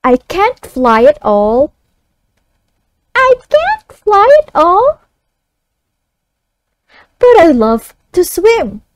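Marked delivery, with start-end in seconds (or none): 3.1-5.0 put-on high child voice echoing back. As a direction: echo it like a young pupil. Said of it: "the child reads it back".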